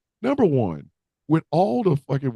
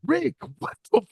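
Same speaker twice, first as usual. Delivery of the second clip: high-pitched disbelief; shocked; 12 semitones higher than usual. Retold or imitated. Robert Gibson appalled at Ricky, high shocked voice. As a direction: voice leaps up, appalled.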